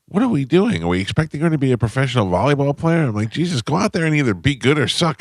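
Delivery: high, fed-up questioning; exasperated rant. Retold or imitated. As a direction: vent in disbelief. Ranting to wife.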